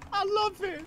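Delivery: high squealing laughter-filled voice; laughing. Real, not acted. Teased friend cracks up at the German guess, laughing squeal.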